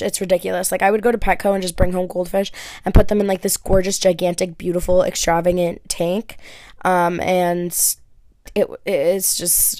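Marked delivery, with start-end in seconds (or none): none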